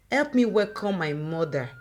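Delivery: ordinary delivery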